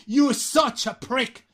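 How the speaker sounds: "such a prick" high and slow, "such" drawn out; slow down and push up on "such"; emphatic SUCH. Shown as a demonstration. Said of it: "todavía lo enfatizáis más". ("such a prick" alto y lento, enfatizando "such")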